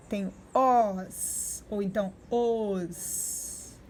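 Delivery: "os" stretched slowly, said two ways; teacher modelling "os" for young pupils; syllable demo. Shown as a demonstration. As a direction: sound out "os" slowly, twice. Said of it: "se eu botar o S depois".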